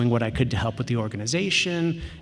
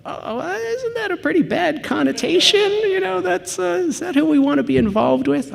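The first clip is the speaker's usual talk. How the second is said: much higher, mock-fretful voice for the doubting board member; worried board member; 6 dB louder than usual. Board member doubting the hacker conference, voiced high and fretful. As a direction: squeak out the nervous objection.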